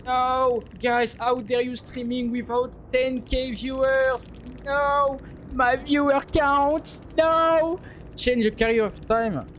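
high, hammed-up whine of outrage; mock outrage. Streamer mocking chat with fake outrage, high hammed-up whine.

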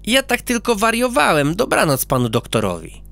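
put-on high sweet little boy; polite goodnight from a child; a touch of a well-mannered boy; boy character.

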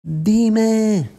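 high, loud drawn-out demand; loud shout. A narrator's reading. Bia demands the truth from Ana, loud shout. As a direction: shout it out, stretching the word. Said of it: "se lo dice gritando fuerte".

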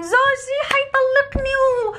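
high, slow, sobbing mock wail; fake crying. YouTuber wife fakes tears about divorce on camera, high slow mock sob. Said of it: "وعم تبكي وبتمثل تمثيل واو".

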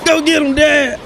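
put-on high, squeaky voice for the baby son; high cartoon voice for the baby backing up dad. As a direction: voice the baby like a tough little man. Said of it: "Kyrie like".

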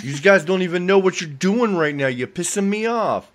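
loud, high mock angry rant in a northern accent; angry northern dad. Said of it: "You gotta be northern if you're gonna be angry".

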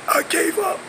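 winded, high and soft; out of breath. Retold or imitated. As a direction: gasp it out winded. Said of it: "Sean lost his breath".

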